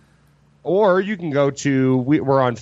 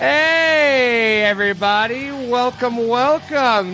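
higher, bright welcome to the crowd; excited host greeting; 8 semitones higher, much slower than usual. Acted. Grand show opener.

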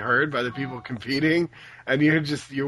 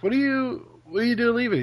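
surprised; 10 semitones higher, faster than usual. Retold or imitated. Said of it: "I was like".

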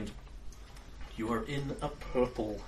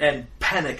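usual speaking voice

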